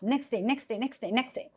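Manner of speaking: NEXT DAY repeated rapidly, the T gliding away; quick run-together "next day"; fast speech. Shown as a demonstration. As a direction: rattle off "next day" over and over. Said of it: "saying in fast".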